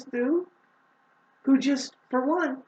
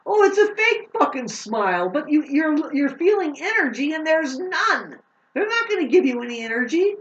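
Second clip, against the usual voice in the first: heated rant; 7 semitones higher, 4 dB louder than usual. Real, not acted. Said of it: "pardon my tone of voice".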